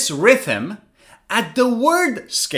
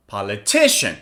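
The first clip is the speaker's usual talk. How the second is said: "Politician" with TI stressed; "Politician" peaking on the third syllable; 9 semitones lower than usual. Shown as a demonstration. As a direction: lean on TI in "Politician". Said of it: "read the three of these words".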